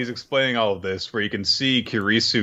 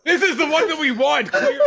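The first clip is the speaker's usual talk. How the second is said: high-pitched comic exclamation; mock excited cry; 13 semitones higher than usual. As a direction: squawk it in an altered high voice. Mock shout at loud parlor.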